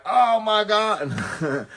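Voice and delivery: shocked. Retold or imitated. Teller hears Corey blame his wife, drawn-out mock shock. Reacting to the joke.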